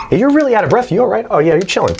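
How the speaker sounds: high, sing-song pet talk; talking to the dog. Real, not acted. Teacher asks his out-of-breath dog if he's okay, high voice. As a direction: check on the dog in a high, caring voice.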